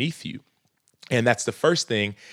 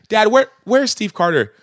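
put-on higher and louder, eager little kid; imitating his son; 10 semitones higher, 5 dB louder than usual. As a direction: ask like an excited five-year-old. Quoting his five-year-old son.

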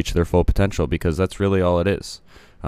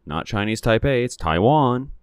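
pointed correction; 6 semitones higher than usual. Real, not acted. Correcting the country's name.

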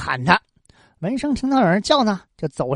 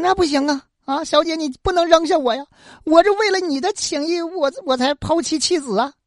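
higher, pleading cry; a man begging the lady not to throw him overboard, higher; desperate begging; 9 semitones higher than usual. Acted. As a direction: beg for your life. Wensheng begs not be thrown.

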